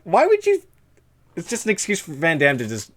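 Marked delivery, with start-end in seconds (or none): 0.0-0.6 high-pitched, louder retold protest; incredulous retort. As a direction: squeal the question in disbelief. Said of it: "I'm like".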